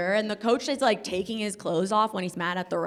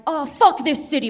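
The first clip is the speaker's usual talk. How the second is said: higher, louder mock outburst; frustrated outburst; 6 semitones higher, 8 dB louder than usual. A New Yorker missing the train, loud and higher. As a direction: curse the city like you missed the train. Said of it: "you're like".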